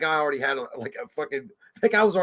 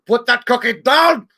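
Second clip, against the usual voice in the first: very high, loud, slow bark; mock Austrian roar at the kids; Schwarzenegger impression; 11 semitones higher, 10 dB louder, slower than usual. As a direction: bellow it like an Austrian action hero. Phone motivation quote.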